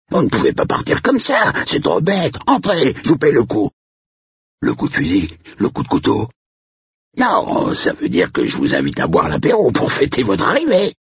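high-pitched, eager country host; affable caretaker, pleading. A narrator's reading. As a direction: beg the guest to stay for a drink. Caretaker turned affable, insisting. (régisseur affable et insistant, voix aiguë)

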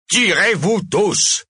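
loud, high, drawn-out order; furious shout. A narrator's reading. Mayor throwing out the whole audience, shouting. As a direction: bellow it slowly at everyone.

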